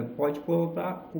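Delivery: normal talking voice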